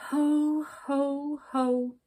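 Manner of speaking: loud, mournful fake laugh; a joyless belly laugh. A narrator's reading. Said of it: "she added mournfully".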